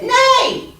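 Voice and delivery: high, loud horse neigh; whinnying horse. Acted. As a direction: goose faking a whinny with all her might. Goose imitates horse.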